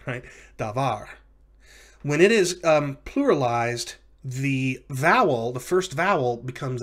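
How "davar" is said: Davar accented on the final VAR; stress on second syllable. Shown as a demonstration. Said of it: "the accent is on the second".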